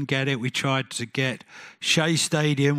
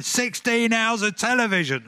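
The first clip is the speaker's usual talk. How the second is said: much higher, insistent, stressed SIXTEEN; table-banging demand; 9 semitones higher than usual. Retold or imitated. Bob banging the table at the BBC, insistent high demand. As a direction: pound out each word like a fist on the table. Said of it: "Bob banging the table like this, going".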